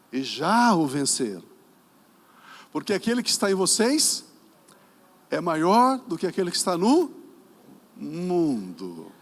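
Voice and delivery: loud, measured verse with long pauses; declaimed scripture. Real, not acted. Preacher reads the verse for the congregation, loud declamation. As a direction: proclaim it, leaving the last word hanging.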